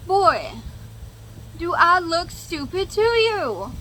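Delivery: high, slow, scornful retort; loud, incredulous and cutting; indignant retort. Retold or imitated. Retorting to ex's claim.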